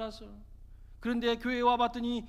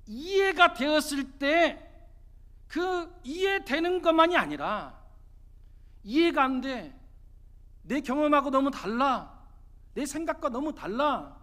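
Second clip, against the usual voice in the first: forceful preaching; 4 semitones higher, 7 dB louder than usual. Real, not acted. Preacher urging the congregation to obey without understanding, forceful preaching. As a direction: drive each phrase home at full pulpit force.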